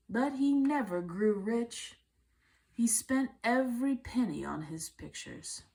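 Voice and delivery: plain reading voice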